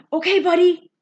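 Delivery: shouted. A narrator's reading. Boy shouting encouragement down to trapped dog. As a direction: shout down brightly to a scared dog. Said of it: "I shouted down to".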